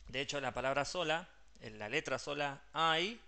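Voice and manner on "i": "I" as a held eye glide; AI diphthong. Shown as a demonstration. Teacher shows the letter alone, "I" as ai diphthong. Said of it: "se dice de esa manera".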